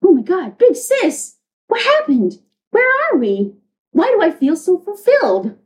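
high, bewildered younger-sister voice; waking little sister. Acted. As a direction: ask big sis in mock wonder. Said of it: "Huntress's sister among them".

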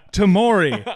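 loud, high hype call; hyped announcement. Acted. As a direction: announce it like a star. Hyped player name.

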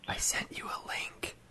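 hushed secretive whisper; worried whisper. Real, not acted. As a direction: whisper so nobody hears. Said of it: "Why are we whispering?".

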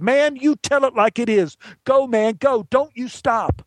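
high-pitched, whooping encouragement; excited cheering. Acted. Fans patting the actor on the back between takes, excited cheering. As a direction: egg him on like a thrilled fan.

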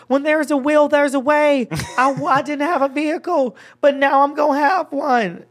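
high, loud victory proclamation; triumphant speech. Retold or imitated. Winner shouting his speech to the crowd, triumphant and loud. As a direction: preach it like you just won big.

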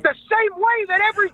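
high-pitched crowing taunt; wild, high fan chant; hollered taunt. Acted. Caller's crazed taunt of rival.

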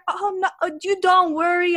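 high, loud put-on Russian accent; reassuring in a mock Russian accent; hammed-up Russian accent, almost shouting; bad Russian accent. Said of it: "I'm bad at doing a Russian accent".

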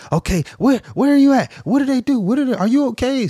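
high, frantic string of questions; hysterical mom. Retold or imitated. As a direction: imitate a mother in a panic. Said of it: "she hysterical".

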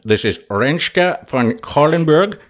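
slow attempt at a Dutch accent on the name. Host introducing his Dutch guest in a mock Dutch accent. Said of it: "in my best Dutch accent".

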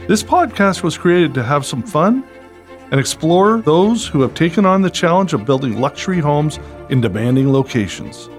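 upbeat announcer read over music; bright, punchy show-opening voice; podcast host intro. Acted.

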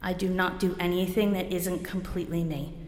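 ordinary talk, nothing marked

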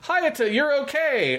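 very high, slow, sing-song hail; relieved call. Retold or imitated. Imitating teammates greeting Hayata, high slow joyful call. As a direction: greet him joyfully from afar. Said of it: "and, like".

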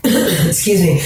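coughing, voice catching after a swallow; choking on a drink. Real, not acted. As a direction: clear the throat mid-choke. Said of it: "coffee went down the wrong hole".

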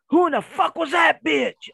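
high, indignant demand; mock the wife's outraged outburst; imitated angry wife. Wife confronts husband over another woman's voicemail, mock outraged.

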